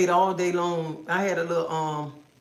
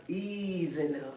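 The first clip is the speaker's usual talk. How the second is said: much softer and slower, easing it out; gentle slow ease; 10 dB quieter, slower than usual. Shown as a demonstration. Telling viewers to go slow, soft slow ease. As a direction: coax it softly, slowly. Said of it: "I told you, go slow".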